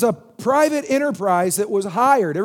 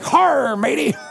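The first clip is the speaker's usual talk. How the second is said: higher, growled mock pirate greeting; 4 semitones higher, slower than usual. Kids in pirate costumes greet their father, mock pirate voice. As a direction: play kids hailing you like pirates. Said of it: "they're all dressed up like Jack Sparrow".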